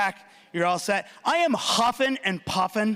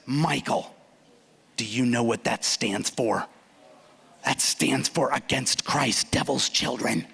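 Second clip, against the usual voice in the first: worried mom; 8 semitones lower, slower than usual. Retold or imitated. Mom warning about AC/DC.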